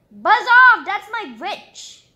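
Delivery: loud, high telling-off from the beast; loud buzz off. Acted. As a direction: shove the dragon away with your voice. Beast tells dragon off.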